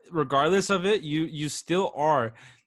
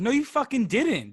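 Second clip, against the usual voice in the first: high-pitched, retold contradiction; disbelieving call-out; 9 semitones higher than usual. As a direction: call out the lie in mock indignation. Repeats how he called out the lie.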